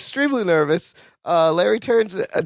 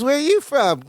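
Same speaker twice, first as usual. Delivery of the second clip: lightly voiced sassy diner lady, higher; a touch of a flirty Southern boss; sassy Fat Marcia; 8 semitones higher than usual. Host retelling the sitcom, lightly voicing Fat Marcia, higher. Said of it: "She goes".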